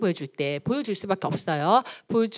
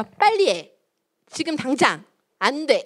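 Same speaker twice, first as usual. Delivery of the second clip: raised-voice mock nagging; bossy parent; 4 semitones higher, 4 dB louder, slower than usual. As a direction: order a child about impatiently. Parent's habitual commands, acted.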